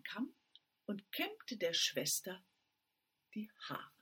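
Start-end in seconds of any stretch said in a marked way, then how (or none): 3.3-3.9 whisper. A narrator's reading. Storyteller hushes a detail.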